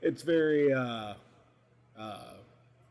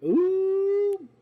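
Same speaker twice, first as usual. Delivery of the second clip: high, loud drawn-out ooh; excited ooh; 7 dB louder than usual. Real, not acted. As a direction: sing out a thrilled ooh. Excited reaction to Mothman news.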